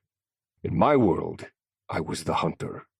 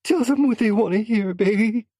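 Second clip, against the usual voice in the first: frightened wife; 6 dB louder than usual. A narrator's reading. Wife pleads with husband.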